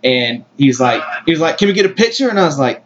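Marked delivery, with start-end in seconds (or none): none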